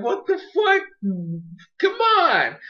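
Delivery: very high-pitched outraged squeal; mock freak-out. Farfetch'd protesting the trade, freaking out. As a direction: act out a hammed-up panicked protest. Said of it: "He's, like, freaking out over here".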